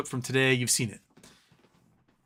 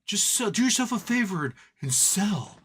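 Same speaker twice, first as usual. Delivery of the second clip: mock advice; 6 semitones higher than usual. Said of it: "Other than, like".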